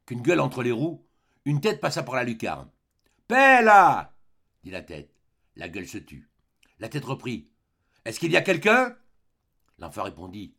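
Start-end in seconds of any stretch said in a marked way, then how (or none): 3.3-4.1 high, loud, drawn-out shout; bellowed command falling at the end. A narrator's reading. Man silences snarling animal. 8.0-9.0 loud, high call with rising question; raised voice, questioning. A narrator's reading. Head calls out into the dark.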